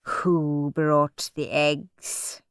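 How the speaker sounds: slow, soft, cold question; icy. A narrator's reading. Miss Battleaxe asks the class coldly, slow and soft. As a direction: ask it coldly and slowly. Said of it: "Her voice was like ice".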